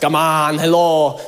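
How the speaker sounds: lightly imitated thick Romanian accent. Teasing Romanian family and friends, imitated Romanian accent. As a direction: put on a Romanian accent briefly. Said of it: "They got the fat accents like".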